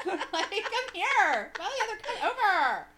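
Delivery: high, loud, slow laughing call; drawn-out laughing summons across a room; mock calling out. Laughing call to an imagined friend, slow and high.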